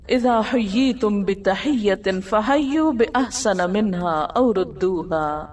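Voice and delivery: slow, measured chanted Arabic recitation; Quranic recitation. Acted. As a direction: recite it slowly and reverently like tilawat.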